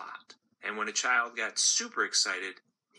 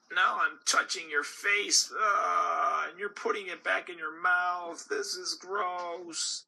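higher, whining, slowing to a drawn-out end; disgusted gumball; 9 semitones higher than usual. Acted. Gumball stuck on the girl's face, higher whining disgust. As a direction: complain to the girl in horror.

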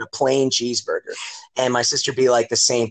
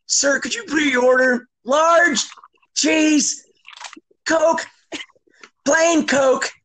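very high mock order voice; imitated flustered ordering, high-pitched; drive-thru exchange; 14 semitones higher, much slower than usual. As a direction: blurt it high and slow, in pieces. Voices drive-thru kid and panicked dad.

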